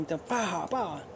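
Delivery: two soft "pah" shots; storyteller's understated gunfire sound effect; imitated gunshots. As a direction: voice two pistol shots, softly.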